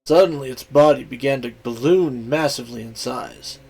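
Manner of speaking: ordinary delivery, nothing marked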